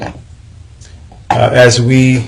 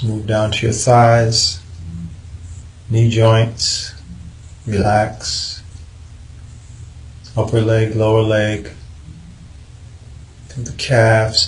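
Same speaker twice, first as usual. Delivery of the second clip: unhurried, even meditation guidance with long pauses; calm body-scan voice, leaving space between instructions; guided meditation; 4 dB quieter than usual. Acted. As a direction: lead them calmly through relaxing the body, phrase by phrase.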